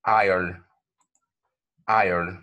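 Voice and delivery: "I'll" said as one clear diphthong syllable; model word. Shown as a demonstration. Teacher modeling a sample word, careful. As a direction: say "I'll" slowly, gliding. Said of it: "For example".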